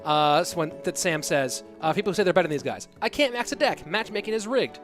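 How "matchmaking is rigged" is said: normal talking voice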